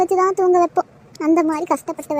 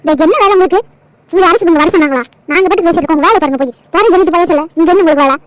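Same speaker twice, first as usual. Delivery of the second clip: angry shouting; 5 dB louder, faster than usual. Acted. Mother shouts son out of room.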